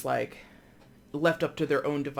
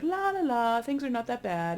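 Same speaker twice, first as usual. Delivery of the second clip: high sing-song la la la; carefree denial; 9 semitones higher than usual. Retold or imitated. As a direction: sing it off like nothing is wrong. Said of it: "This person is just like".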